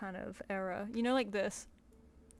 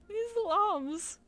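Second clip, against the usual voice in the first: adoring; 10 semitones higher, 5 dB louder, slower than usual. Real, not acted. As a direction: coo over something tiny and cute. Cooing over tiny plush.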